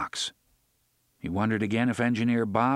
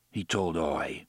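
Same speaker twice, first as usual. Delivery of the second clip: ordinary delivery, nothing marked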